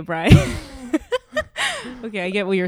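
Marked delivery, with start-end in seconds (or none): 0.4-2.0 a squealing laugh, well above her usual voice; real laughter at the crude joke; high burst of laughter. Real, not acted. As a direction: break into high, helpless laughter.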